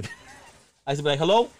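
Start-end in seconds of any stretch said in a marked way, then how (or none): 1.2-1.5 higher, louder phone greeting; a raised, questioning hello as the caller; polite young caller. Retold or imitated. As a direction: call up a friend's house. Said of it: "I used to call there".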